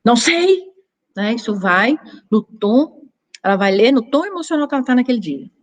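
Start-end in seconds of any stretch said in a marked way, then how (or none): 0.0-0.7 "Não sei." higher and louder, falling on "sei"; curt, louder reply. Shown as a demonstration. Answering a message curtly, higher and louder. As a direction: snap it back short. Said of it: "E ela vai ler com o tom".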